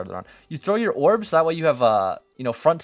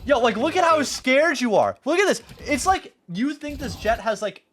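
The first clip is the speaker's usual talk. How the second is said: much higher and louder, slowed pleading; exasperated shouting; 11 semitones higher, 5 dB louder than usual. Real, not acted. Coach shouting at frightened student on replay, exasperated. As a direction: yell at him to just take the fight.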